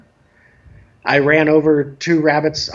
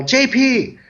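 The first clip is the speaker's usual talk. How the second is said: mock kid's yell, pitched up high; retold yell; 8 semitones higher than usual. Kid calls from the living room, retold yell. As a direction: imitate an impatient child calling out, high-pitched. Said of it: "finally decides to yell".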